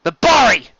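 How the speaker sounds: high, loud warning cry; sudden shouted warning of enemies; alarmed shout. Acted. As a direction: yell the alarm. Sheridan senses ambush.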